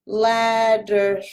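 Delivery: ordinary talk, nothing marked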